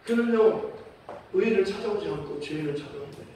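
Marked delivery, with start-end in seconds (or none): none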